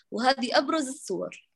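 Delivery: normal talking voice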